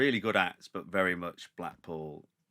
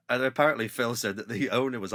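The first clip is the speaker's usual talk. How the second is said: ordinary talk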